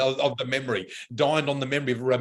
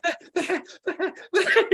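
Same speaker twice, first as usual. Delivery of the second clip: very high, laughing through each word; imitating helpless laughter; 14 semitones higher than usual. Mother-in-law on the train, imitated squealing through laughter. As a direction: squeal the words while laughing. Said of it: "She couldn't even say the hat".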